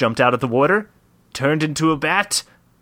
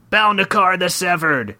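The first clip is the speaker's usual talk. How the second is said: shouted name, pitched up; yelling warrior; 6 semitones higher than usual. Acted. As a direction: call out the villain's name. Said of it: "yelled the warrior".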